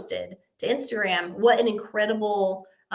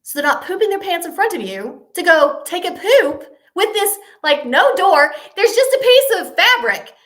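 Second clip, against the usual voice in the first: high, loud comic outrage; aghast disbelief; 7 semitones higher, 8 dB louder than usual. Retold or imitated. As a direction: protest it, louder as it builds. Aghast at bathroom without a door.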